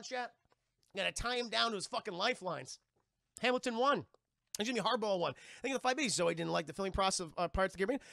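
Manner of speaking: normal talking voice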